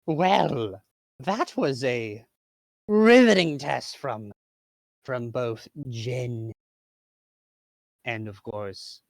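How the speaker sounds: drawn-out mocking villain voice. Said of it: "Baxter goes".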